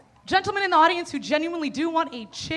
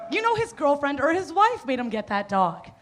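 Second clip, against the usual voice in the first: ordinary talk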